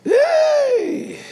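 very high, ringing cry of joy; joyful shout. Real, not acted. Preacher cheering the Holy Ghost before the congregation. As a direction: let out a big celebratory yell.

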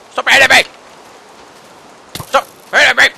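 loud, high protest; frustrated yell. Real, not acted. Yelling at mobs attacking him, loud frustrated protest. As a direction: shout it out while getting hit.

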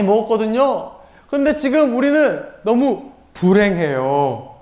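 high-pitched acted grumbling; complaining. Israelites wishing they were back in Egypt, high-voiced complaint. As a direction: play a discontented moan, slowing at the end. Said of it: "불평을 하고 있어요".

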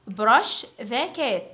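put-on very slow, clear drill phrase for children to repeat; slow model phrase. Shown as a demonstration. Teacher to fifth graders, model phrase very slowly. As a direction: stretch each word out for the class. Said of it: "Repeat after me".

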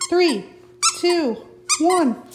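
high, eager counting; excited countdown. Real, not acted. Owner counts down for the dogs, high and eager. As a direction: build the dogs' anticipation. Countdown before tossing toy.

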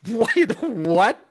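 high, loud startled double take; hammed-up disbelief, pitched high; mock shock. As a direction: retell a stunned outburst. Retelling his shock at news.

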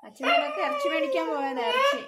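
loud wailing coax over a crying animal; high coaxing cry. Real, not acted.

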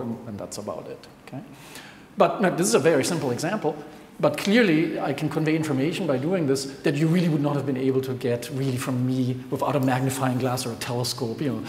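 ordinary delivery

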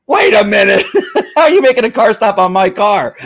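high, loud, laughing mock protest; indignant surprise. Dispatcher protesting a car stop on her own car, high and laughing. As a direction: catch the joke and protest, laughing.

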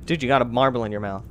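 ordinary delivery

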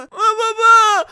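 high, loud, drawn-out cry; stretched childlike shout; child calling father. Pretend. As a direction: squeal out as a little son. Son greets father.

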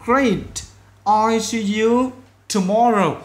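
loud, high, slow model line; friendly goodbye demo. Shown as a demonstration. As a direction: wrap up the chat kindly. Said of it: "tương tự". (chào tạm biệt mẫu, chậm và to)

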